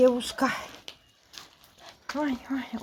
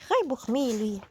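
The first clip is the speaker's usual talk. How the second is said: usual speaking voice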